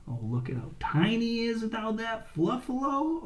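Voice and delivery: usual speaking voice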